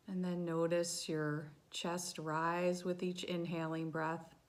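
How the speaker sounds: soft, hushed meditation voice; quiet, settling body-scan narration; guided meditation. Real, not acted. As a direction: guide them gently through a body scan, softly and with long pauses.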